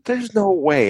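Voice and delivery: disbelief. Real, not acted. Disbelief at the film's length.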